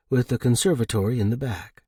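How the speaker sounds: usual speaking voice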